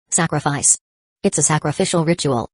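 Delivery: ordinary delivery, nothing marked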